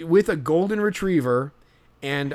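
normal talking voice

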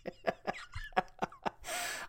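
breathless wheeze of laughter; wheezing silent laugh. Real, not acted. Breaks up reading the letter.

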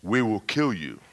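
lightly voiced male demon; a hint of a menacing demon man; demonic male voice. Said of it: "then this male voice said".

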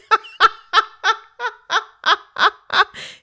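high-pitched giggling fit; loud squealing laugh; high helpless giggle. Acted. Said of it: "couldn't stop laughing".

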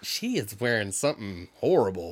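hammed-up catty remark; mock catty guest. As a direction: gossip about an outfit. Gossiping guest voiced.

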